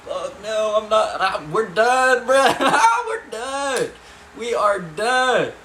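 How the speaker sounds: high, loud, laughing outcry; reenacted alarm, breaking into a laugh; panicked mock protest. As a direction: shout it like you want out right now. Boys reacting to the threat.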